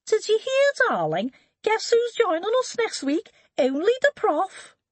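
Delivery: put-on high falsetto, posh landed gentry accent; mock a landed gentry lady in falsetto, gushing. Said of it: "mimicking the accent of the landed gentry in a falsetto".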